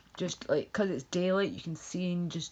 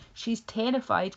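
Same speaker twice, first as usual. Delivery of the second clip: usual speaking voice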